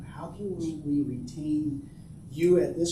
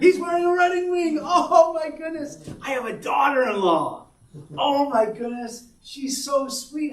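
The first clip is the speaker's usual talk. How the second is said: high, loud, delighted outburst; overjoyed at the news, much higher and louder; excited shout; 12 semitones higher, 10 dB louder than usual. Real, not acted. Showing son's wedding photo.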